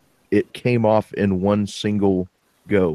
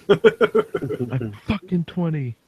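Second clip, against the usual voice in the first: high, slow mocking echo with a laugh; laughing mock repeat; 7 semitones higher, much slower than usual. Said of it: "laughs, repeats mockingly".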